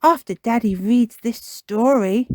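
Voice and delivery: put-on high, loud child insisting; insistent. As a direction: push it out like a child demanding her way. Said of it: "Peppa insists".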